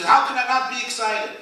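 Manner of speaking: gleeful husband bursting out; excited exclamation. Retold or imitated. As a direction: burst out with glee at the good deal. Same reply, repeated louder.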